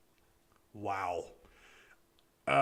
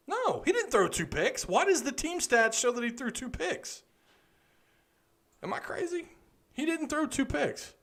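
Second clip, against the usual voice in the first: incredulous; 11 semitones higher than usual. Real, not acted. Disputing the stats, disbelieving.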